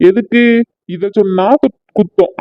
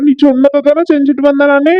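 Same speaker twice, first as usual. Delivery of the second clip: normal talking voice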